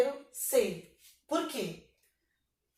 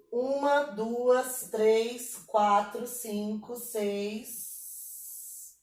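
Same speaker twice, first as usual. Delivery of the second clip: counting out; much slower than usual. Shown as a demonstration. Teacher counting the second verse's syllables, measured counting. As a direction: count slowly, then stretch the final number. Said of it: "vamos contar aqui".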